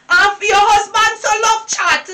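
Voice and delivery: ordinary delivery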